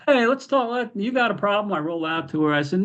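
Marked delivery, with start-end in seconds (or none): 0.1-1.7 mock heel taunt. Heel confronting the mom.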